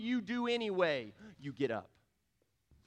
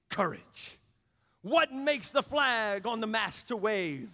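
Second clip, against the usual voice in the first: loud, high blustering lion; lion's courage speech; 6 semitones higher, 5 dB louder than usual. Retold or imitated. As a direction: boast like the Cowardly Lion. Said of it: "And then the lion says".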